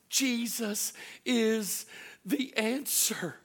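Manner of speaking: preaching climax. Acted. Pastor declaring hope to the congregation, slow and loud. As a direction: proclaim each word, drawn out.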